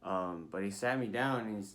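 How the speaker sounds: ordinary delivery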